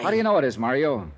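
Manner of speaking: detective cornering the suspect, raising his voice; pressing shout. Acted.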